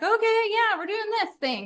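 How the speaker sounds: high, bright, eager yes; excited going along. Retold or imitated. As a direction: join in with delight. Copying dance moves, excited.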